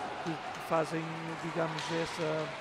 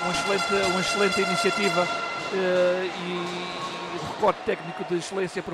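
loud, enthusiastic praise; excited commentary; 8 dB louder than usual. Real, not acted. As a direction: celebrate the goal loudly. Co-commentator celebrates goal.

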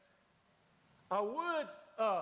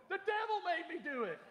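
much higher, sing-song excuse; mock innocent wife; 12 semitones higher than usual. Wife excusing the expensive shoes, mock innocent. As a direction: play the caught wife, innocently.

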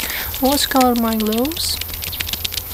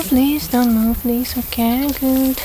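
ordinary talk, nothing marked

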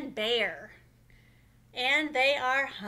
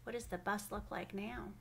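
usual speaking voice

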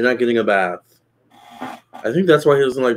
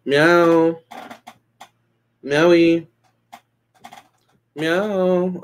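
high cooing meows; cat meow; 6 semitones higher, much slower than usual. Retold or imitated. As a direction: mew sweetly at the cat. Calling the cat.

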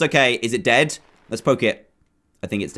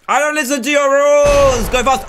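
defiant yell; 7 semitones higher, 4 dB louder than usual. Pretend. Player refusing the game's rules, mock defiant yell. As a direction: holler it in playful defiance.

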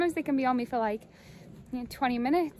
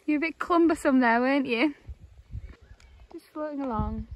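teasing a swan; 2 semitones higher, 6 dB louder than usual. Real, not acted.

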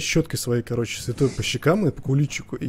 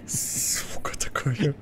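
breathless shaken whisper, slow; barely voiced after a fright; scared whisper; 6 dB quieter, much slower than usual. Real, not acted. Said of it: "напуган просто до усрачки". (испуганный шёпот)

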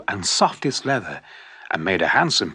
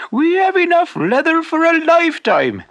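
much higher, louder, delighted; happy wife; 5 dB louder than usual. Acted. Happy wife telling her husband they are almost rich, delighted. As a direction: burst out with joy. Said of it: "said the shoemaker's happy wife".

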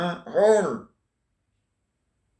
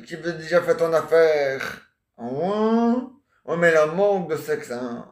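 ordinary talk

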